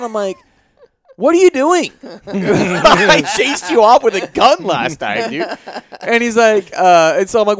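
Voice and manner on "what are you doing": high, loud mock disbelief; incredulous. Homeowner confronting the returning intruder, retold as incredulous. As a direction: challenge him in a raised, swooping voice.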